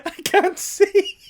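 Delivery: laughing. Retold or imitated. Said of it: "He goes".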